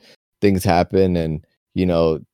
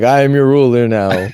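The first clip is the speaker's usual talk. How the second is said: louder, proclaiming like a tiny tyrant king; mock dwarf ruler; 5 semitones higher, 7 dB louder than usual. Dwarf king proclaiming to his people, mock regal. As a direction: announce yourself as their new ruler. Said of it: "but just be like".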